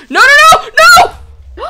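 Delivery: very high, loud shout; panicked scream. Real, not acted. Screaming as a fairy nearly dies, panicked. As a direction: scream in sheer alarm.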